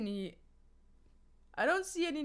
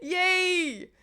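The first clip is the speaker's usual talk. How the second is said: excited cheer; 7 semitones higher, 8 dB louder than usual. Real, not acted. Cheering at chat.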